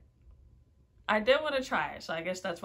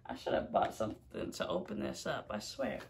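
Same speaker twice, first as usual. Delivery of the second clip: muttered whisper; 10 semitones lower, 5 dB quieter than usual. Real, not acted. Muttering while struggling with packet.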